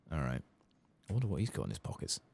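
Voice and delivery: ordinary delivery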